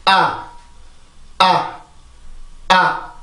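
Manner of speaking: 아 with an open jaw and a tense throat; 아 repeated as a stressed English vowel. Shown as a demonstration. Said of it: "해볼게요".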